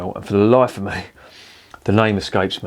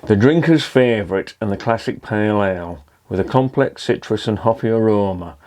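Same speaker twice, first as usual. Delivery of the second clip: ordinary talk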